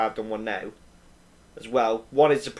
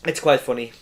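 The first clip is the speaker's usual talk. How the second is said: ordinary talk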